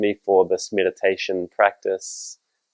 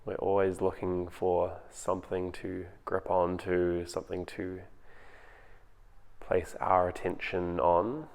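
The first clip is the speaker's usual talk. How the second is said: calm, unhurried meditation voice with long pauses; guided meditation; 10 dB quieter than usual. Acted. Teacher guiding home listeners through their breathing, in a calm meditation voice. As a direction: ease them into the breath, gently.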